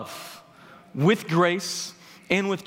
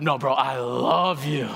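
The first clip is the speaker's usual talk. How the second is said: slow, lower, pressing the word love home; insistent brotherly love; 5 semitones lower, slower than usual. Retold or imitated. Anthony repeating himself to the speaker: slow, lower, earnest insistence. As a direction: lean in and insist, slowly and lower. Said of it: "Anthony likes to repeat himself".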